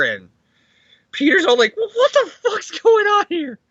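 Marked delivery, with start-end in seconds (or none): 1.8-3.6 very high, crying-out disbelief; hammed-up freak-out in a high voice; bewildered outburst. Acting out Peter seeing the dead return, high bewildered cry.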